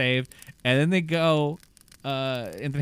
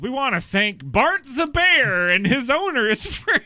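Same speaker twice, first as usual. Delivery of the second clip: higher, louder, slow grand announcer read; mock credits announcement; 11 semitones higher, 7 dB louder than usual. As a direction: boom it out like a pompous movie credit. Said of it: "the first thing that pops up in the credits is goes".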